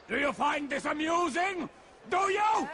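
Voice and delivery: furious. Acted. Paranoid commander accusing his guard, furious. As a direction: jab the question at him.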